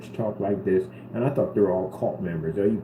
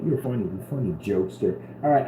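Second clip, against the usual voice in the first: usual speaking voice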